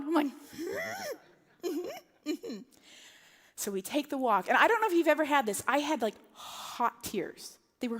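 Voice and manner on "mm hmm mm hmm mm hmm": high, tight, bitter-laughing mm-hmms; sarcastic mm-hmm. Retold or imitated. Mother sent to the courtesy phone again, bitter high hums. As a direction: hum your disbelief.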